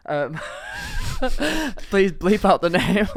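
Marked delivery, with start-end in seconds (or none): 0.4-1.9 high laughter. Real, not acted. Laughing at the story.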